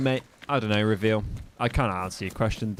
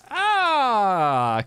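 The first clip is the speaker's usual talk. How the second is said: loud, high drawn-out "aah", sliding down; long frustrated yell; 10 semitones higher, 7 dB louder than usual. Real, not acted. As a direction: yell out a long falling cry of annoyance. Game key press goes wrong.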